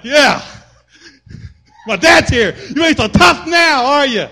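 put-on high, loud, slow child's taunt; boy taunting bullies now that dad is here; gloating kid. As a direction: gloat with dad behind you.

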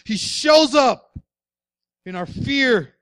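loud, slow and high; preaching shout. Real, not acted. Preacher to congregation, shouting at the climax. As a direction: shout it out to the back row.

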